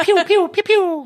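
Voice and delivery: high-pitched, loud "pew" noises; imitated laser shots. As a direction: mimic a toy laser gun firing, high and playful.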